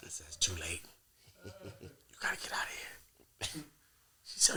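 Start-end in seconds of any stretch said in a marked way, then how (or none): 0.3-2.9 hushed unvoiced whisper; whispered dismissal. Telling the woman she must leave, in a whisper. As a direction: whisper the verdict coldly.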